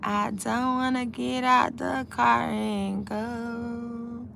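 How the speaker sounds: slow, high sing-song moan; playful whine. Pretend. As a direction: stretch every word out in mock reluctance. Playful reluctance to leave car.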